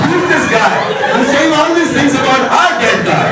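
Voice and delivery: high-pitched mock indignation; offended relatives. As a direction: protest like scandalized mourners. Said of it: "The guys are now offended".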